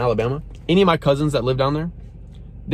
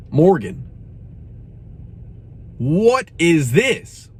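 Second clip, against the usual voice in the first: exasperated; 2 semitones higher, much slower than usual. Real, not acted. Reviewer appealing to [name], slow and exasperated. Calling out the singer by name.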